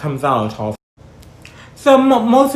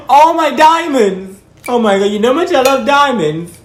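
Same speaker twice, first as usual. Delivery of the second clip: gleeful gushing; 10 semitones higher, 7 dB louder than usual. Real, not acted. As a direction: gush over something you adore, high and loud. Gushing over the diamonds she left with.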